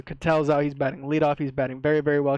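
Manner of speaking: usual speaking voice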